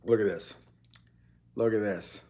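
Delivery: ordinary talk, nothing marked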